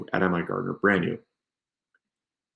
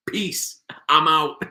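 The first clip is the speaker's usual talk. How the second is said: mock viewer quitting; 6 semitones higher, slower than usual. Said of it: "I'd be like".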